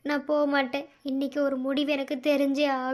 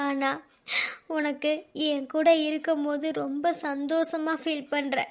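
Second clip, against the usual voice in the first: ordinary talk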